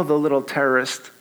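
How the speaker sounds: ordinary delivery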